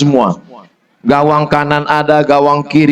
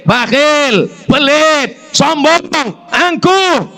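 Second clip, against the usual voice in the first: each word higher than the last; rising accusation; 10 semitones higher, slower than usual. Acted. Preacher scolding a husband, each fault pitched higher. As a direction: climb up the list of faults.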